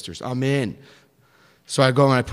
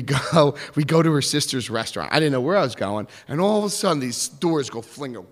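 normal talking voice

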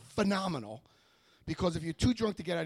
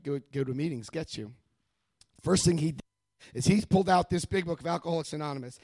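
usual speaking voice

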